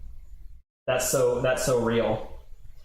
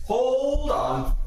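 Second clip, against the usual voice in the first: startled high yelp; 11 semitones higher than usual. Real, not acted. Sudden mess, trash can missing.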